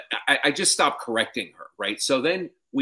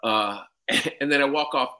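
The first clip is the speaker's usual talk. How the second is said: ordinary talk, nothing marked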